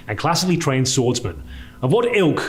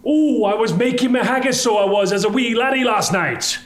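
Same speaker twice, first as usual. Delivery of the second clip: hammed-up Scottish brogue, pitched higher; mock Scottish; 9 semitones higher than usual. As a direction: play a proud wee Scottish laddie. Boasts of Scottish roots.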